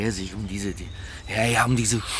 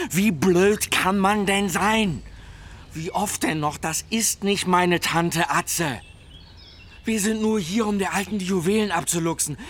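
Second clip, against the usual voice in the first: angry scolding; 11 semitones higher, 4 dB louder than usual. Acted. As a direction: yell at the idiot sidekick. Boss berates dim henchman.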